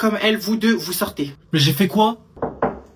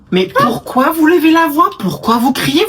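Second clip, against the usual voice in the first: raised indignant voice; 7 semitones higher, 4 dB louder than usual. Acted. Accusing the other of shouting.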